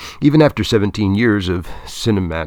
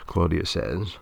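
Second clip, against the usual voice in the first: ordinary delivery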